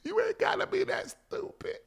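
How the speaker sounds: high scolding whine; exasperated. Real, not acted. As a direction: scold them in disbelief.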